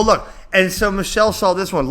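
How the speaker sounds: ordinary delivery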